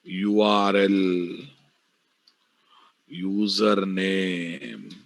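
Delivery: each name drawn out slowly; slow dictation. Shown as a demonstration. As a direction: read it out slowly for notes. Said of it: "teacher dictating property names".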